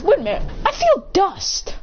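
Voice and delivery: high, startled discovery; sudden realization. Acted. Sai feeling his teammate's back, sudden surprise. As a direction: pitch jumps up in mock surprise.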